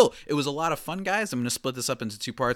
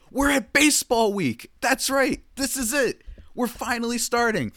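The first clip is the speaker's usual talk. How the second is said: hyped announcement; 11 semitones higher, 4 dB louder than usual. Real, not acted. Host hyping start of season.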